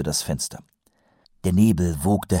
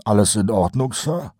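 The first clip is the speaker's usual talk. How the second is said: Colon's higher voice, a casual question; sergeant asking; 4 semitones higher than usual. A narrator's reading. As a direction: ask it lightly the first time. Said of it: "wiederholte Kolon". (beiläufig gefragt, Kolons Stimme)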